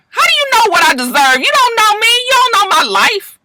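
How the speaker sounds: high, heated comeback; indignant retort. Retold or imitated. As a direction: throw it back at him, high and offended. Charity snapping at Michael.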